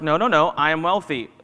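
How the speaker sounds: loud, emphatic self-insistence; insistent affirmation. Acted. Arguing with your own mind, insistent and loud.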